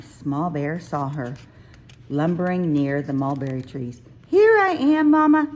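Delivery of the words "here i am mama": high, loud, glad cry; much higher and louder, relieved to find her; joyful reunion. A narrator's reading. Cub finally reaches Mama, high and loud with joy. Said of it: "she said".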